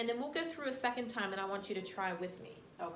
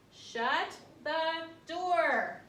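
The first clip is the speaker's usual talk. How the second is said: slow, louder and higher "Shut the door"; excited title; 5 semitones higher, 5 dB louder, much slower than usual. Shown as a demonstration. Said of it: "we want to be excited when we're reading it".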